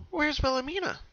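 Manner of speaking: high, loud worried cry. Acted. Pinkie searching the picture for her sister, worried and loud. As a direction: call out for the missing sister in alarm.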